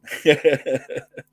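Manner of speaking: a burst of real laughter; loud laugh. Real, not acted. Laughs after southern accent remark.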